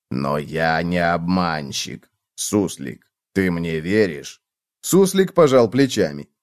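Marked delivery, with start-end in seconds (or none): 0.1-4.4 low, slow, soft appeal; quiet low earnest bug voice; pleading cockroach. A narrator's reading. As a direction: ask for trust, low and drawn out.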